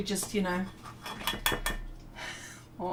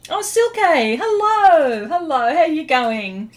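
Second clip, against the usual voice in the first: excited greeting; 6 semitones higher, 8 dB louder, slower than usual. Real, not acted. Greeting a chat viewer.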